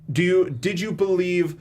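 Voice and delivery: usual speaking voice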